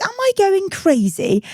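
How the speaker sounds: high, slow and disbelieving; asking the room if she's lost it; mock exasperation, drawn out and high; incredulous. Quoting herself, baffled at boyfriend.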